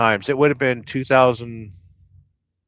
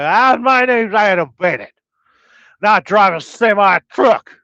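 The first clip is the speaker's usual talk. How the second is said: loud, high Southern accent, hammed up; imitating Adam Bennett's Southern accent; 9 semitones higher, 9 dB louder than usual. Streamer introducing himself as a semi driver, hammed-up Southern accent. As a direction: belt out a trucker's Southern accent. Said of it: "what part of the South would you say".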